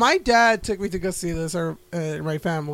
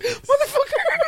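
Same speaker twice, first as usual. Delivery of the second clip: much higher, the curse squeaked out through laughter; laughing curse. Retold or imitated. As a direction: blurt the curse while laughing helplessly. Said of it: "I was like".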